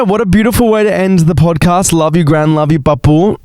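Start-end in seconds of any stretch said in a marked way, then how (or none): none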